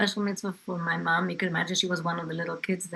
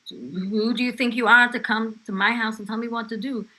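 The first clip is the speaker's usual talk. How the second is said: higher, louder defiant retort; retold indignant challenge; 4 semitones higher, 7 dB louder than usual. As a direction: stand up to the intruder. Grandmother defies policewoman.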